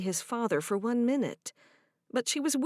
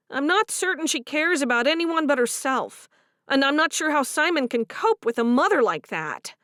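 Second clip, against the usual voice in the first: higher and louder, complaining; frustrated young wife; 5 semitones higher, 6 dB louder than usual. Acted. Favor complaining about Harriet, higher and aggrieved. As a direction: vent about the mother-in-law. Voiced character dialogue.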